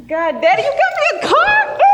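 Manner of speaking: a high, loud cry of joyful shock; excited shout. Acted. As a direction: scream it out, overwhelmed by the gift. Daughter gets car from dad.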